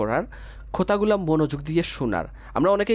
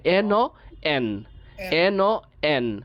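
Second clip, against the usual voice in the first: very slow, each sound held apart; much slower than usual. Shown as a demonstration. Teacher to students, sounding out slowly. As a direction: spell it out slowly, one sound at a time. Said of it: "এতটুকুতে থামি আগে".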